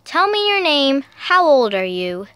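ordinary delivery

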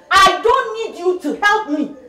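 mother rejecting daughter's help; angry rejection. Acted. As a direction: hammer each word home.

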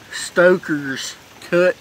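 ordinary talk

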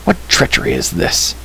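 high, hushed mock shout; outraged demand, held back in volume; restrained shout of accusation. A narrator's reading. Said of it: "he shouted".

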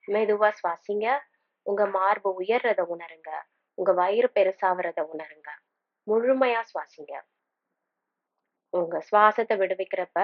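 unhurried meditation guide, long pauses between phrases; calm, spaced-out instructions with room to breathe; guided meditation. Acted. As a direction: lead them gently through breathing and imagery.

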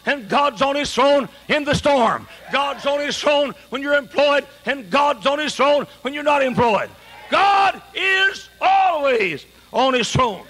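rising, shouted pulpit proclamation, slowing at the end; full-force preaching. Acted. Preacher declaring to the congregation, full-force preaching. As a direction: preach it out to the whole hall.

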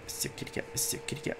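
hushed unvoiced whisper, repeated. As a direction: whisper it as if calling a cat secretly. Keeps whispering to the cat.

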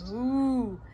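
loud, drawn-out moo; cow's moo. Acted. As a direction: moo out like a cow. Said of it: "And the cow said".

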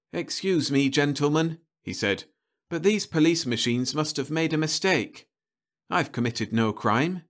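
lightly voiced young man, politely protesting; a polite, nervous young suspect; young man pleading. Young Richard Roe pleads innocence.

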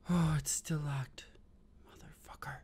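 whispered curse. Real, not acted. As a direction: whisper it under your breath. Tense dark horror game.

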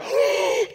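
high shocked gasp; sharp gasp. Acted. Pastor catching his own word, a sharp high gasp. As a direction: gasp as if caught out.

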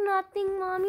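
put-on innocent kid voice. Child denies to mother.

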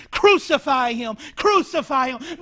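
loud mob shout; crowd crying out. Retold or imitated. Chief priests cry out against Jesus, loudly shouting. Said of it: "they cried out".

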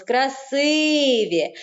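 slow КРАСИВІ with the И drawn out; stress on И. Shown as a demonstration. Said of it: "на звук И буде падати наголос". (КРАСИВІ повільно, з наголосом на И)

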